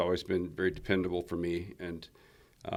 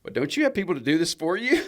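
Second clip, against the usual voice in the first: cashier asking; 9 semitones higher, 7 dB louder than usual. Retold or imitated. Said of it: "She goes".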